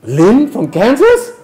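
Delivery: high loud slow rising question; surprised. Real, not acted. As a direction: marvel at the name. Surprised at the sender.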